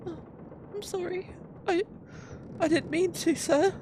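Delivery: very high, meek captive voice; timid apology. Acted. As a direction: apologise in a small high pitch. Captive apologises to angry guard.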